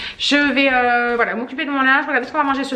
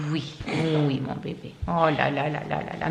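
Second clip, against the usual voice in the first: soothing; 6 semitones lower, 5 dB quieter than usual. Real, not acted. Soothing the pet.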